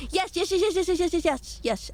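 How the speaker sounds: rapid eager yeses; excited yes. Real, not acted. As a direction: gush yes over and over. Eager answer to question.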